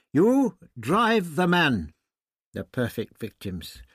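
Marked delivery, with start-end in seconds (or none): 0.1-1.9 slow, reproachful. A narrator's reading. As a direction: reproach him slowly. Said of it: "voice was reproachful".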